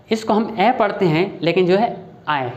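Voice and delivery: normal talking voice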